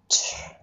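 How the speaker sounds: チュー as a voiceless sliding tr; 滑るチュー. Shown as a demonstration. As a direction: let チュー glide. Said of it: "滑るような音ね".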